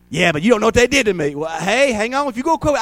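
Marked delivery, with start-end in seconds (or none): 0.1-1.4 fast, louder complaining excuse; mock whiny excuse. Pastor imitating an offended member's quick whiny excuse. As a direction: rattle off a self-pitying excuse.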